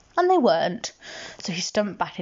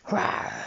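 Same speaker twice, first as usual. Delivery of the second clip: soft, breath-only pretend roar; small playful tiger growl; 6 dB quieter than usual. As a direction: give a gentle mock roar. Imitates tiger after guessing.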